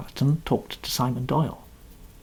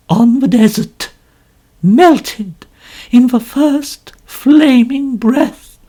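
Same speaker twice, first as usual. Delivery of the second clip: theatrical novelist; 10 semitones higher, 7 dB louder than usual. A narrator's reading. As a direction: savour every word of the title. Mrs Otterbourne declaims her title.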